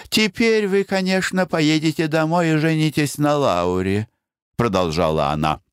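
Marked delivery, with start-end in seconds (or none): 0.1-4.1 Blanche, coaxing. Acted. Бланш упрекает Пена.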